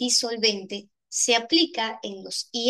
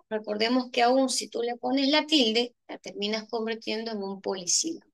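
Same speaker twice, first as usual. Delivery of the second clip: ordinary talk, nothing marked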